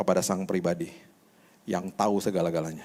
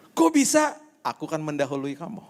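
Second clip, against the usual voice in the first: voicing Jesus; 5 semitones higher than usual. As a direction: speak as Jesus, playfully surprised. Jesus voiced speaking to believer. (suara Yesus yang tinggi dan heran)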